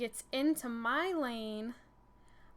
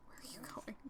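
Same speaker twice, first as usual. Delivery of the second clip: hushed, whispered question off to the side; whispered aside; 14 dB quieter, faster than usual. Real, not acted. Aside to someone off the story.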